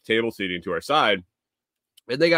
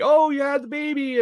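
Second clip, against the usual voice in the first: high-pitched mock cooing question; put-on high chatty voice; imitated fawning diner. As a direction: mimic an acquaintance fussing over a new baby. Imitating diners greeting new mother.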